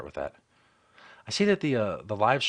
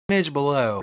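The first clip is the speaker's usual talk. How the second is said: high, louder SMIDGE falling through below; emphatic ranking; 4 semitones higher, 6 dB louder than usual. Real, not acted. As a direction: punch smidge high, then drop on below. Comic ranking, repeats the hedge.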